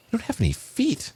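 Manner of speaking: very high, disbelieving question; acted astonishment; incredulous. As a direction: echo it back in high-pitched disbelief. Guy astonished by parrot's answer.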